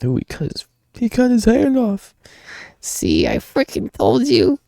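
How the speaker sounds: very high, squeaky reaction; squeamish squeal. Pretend. Reader reacting to a severed hand, high squeamish squeal. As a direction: squeal it in falsetto. Reacting to gory comic panel.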